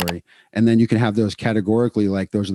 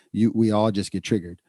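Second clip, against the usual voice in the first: ordinary talk, nothing marked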